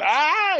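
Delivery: sudden high yelp of pain; acting out the fake shock victim; mock pained yelp. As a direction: yelp like you just got zapped.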